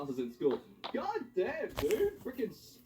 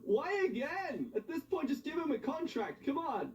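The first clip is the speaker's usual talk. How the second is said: exasperated; 13 semitones higher than usual. Acted. Exasperated reaction to news.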